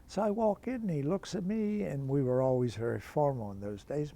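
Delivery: ordinary delivery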